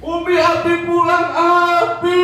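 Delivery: very high, loud and thrilled; overjoyed child. Pretend. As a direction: shout happily that dad is home. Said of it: "Ini anaknya luar biasa". (anak berteriak girang menyambut ayah pulang)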